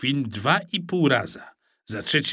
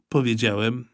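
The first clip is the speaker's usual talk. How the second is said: plain reading voice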